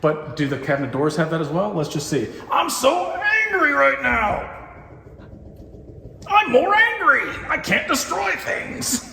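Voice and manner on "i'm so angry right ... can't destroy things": very high, slow, hammed-up fake fury; mock anger. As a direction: act furious but it comes out silly. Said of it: "Why is my angry not showing?".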